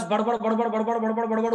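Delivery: rattled-off, run-on babble; mock blabbering. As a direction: imitate someone yammering on without a pause. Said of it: "वो बोलते जाएंगे".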